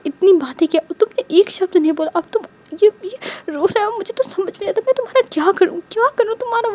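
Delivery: ऊँची आवाज़ में खीझकर डाँटना; झल्लाकर सवाल पटको; झल्लाहट भरी डाँट. A narrator's reading. रोते वांग जी पर झल्लाना. (ऊँचे सुर में खीझ भरी डाँट)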